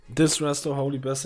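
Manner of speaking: ordinary talk, nothing marked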